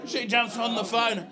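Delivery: ordinary talk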